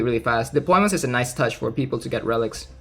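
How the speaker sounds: normal talking voice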